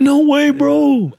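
very high, drawn-out, loud exclamation; mock over-the-top excitement, stretched and squealed; excited drunk friend. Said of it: "he gets excited".

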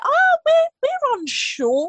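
high, drawn-out mock uncertainty; unsure group answer. Recounting the group's answer to friends, exaggeratedly unsure. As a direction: stretch it out high and loud.

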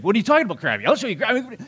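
loud, high, indignant snapping back; hammed-up fury at being called crabby; retold angry outburst. Husband to wife, denying he's crabby, in a mock angry outburst. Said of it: "And then you get angry".

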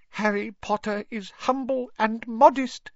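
very high squeaky elf voice; high-pitched little creature overcome with gratitude; Dobby, grateful and awed. Acted. Grateful elf praising Harry Potter's goodness, high awed voice.

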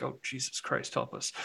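soft, exasperated aside; muted mock dismay. Recalling a cheer at the Matrix trailer, quiet mock despair. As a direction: plead quietly, as if to oneself. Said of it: "and I was like".

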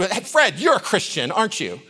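imitating a pointed, mocking challenge. Coworker ranting about Christians singles him out, mocking. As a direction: put him on the spot, mock-accusing. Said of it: "she starts mocking me".

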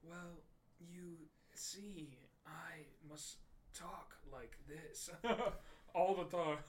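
mock ponderous speech, long gaps between words; slow-talker impression. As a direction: imitate someone laboring out each word. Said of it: "He talks".

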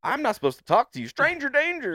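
high, loud put-on boy voice; mock-alarmed child. Boy refusing the creepy man, mock-alarmed shout. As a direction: raise a playful alarm as the boy.

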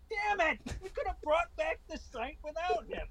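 high-pitched mock regret; squeaky mock frustration. Twitter staff voiced regretting.

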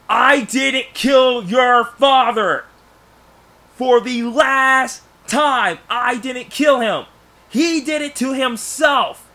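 acted yell, high, loud and drawn out; furious yell. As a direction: yell it slowly in someone's face, high and loud. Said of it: "he's yelling that at his face".

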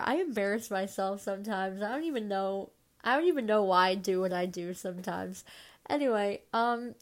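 ordinary delivery

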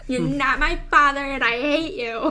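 hammed-up tantrum, high and loud; mock angry daughter. Playing a daughter rejecting her father, mock-angry and high. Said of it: "That sounds real daughter-like".

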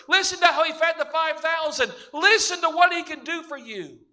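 high, loud shouted acclaim of a rejoicing crowd; a crowd hailing their king at full voice; loud crowd praise. Acted. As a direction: cry it out like the crowd on the road. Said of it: "with a loud voice".

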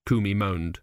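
ordinary delivery, nothing marked

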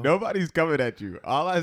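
high, loud shouted insistence; way too loud. Real, not acted. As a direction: shout your defence over the co-host's complaint. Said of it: "You're way too loud".

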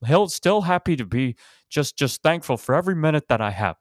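put-on high-pitched, overjoyed old man impersonation; mock-cheery elderly star. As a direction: gush with hammed-up gratitude, pitched well up. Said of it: "he's so happy to see him".

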